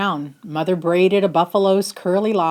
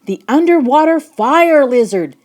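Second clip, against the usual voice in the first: urgent warning; 7 semitones higher, 6 dB louder than usual. Acted. Storm at sea, monster rising.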